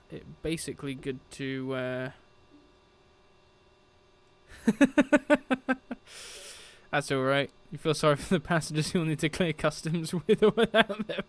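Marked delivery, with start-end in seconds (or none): 4.5-6.5 high, loud burst of laughter; real laughter. Real, not acted. Amused at the stream chat.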